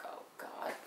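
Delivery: soft unvoiced whisper; hushed whispered eye-roll; whispered aside. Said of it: "I'm like".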